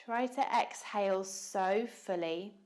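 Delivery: calm, unhurried yoga teacher voice with long pauses; measured meditation cueing, leaving room to breathe; guided meditation. Real, not acted.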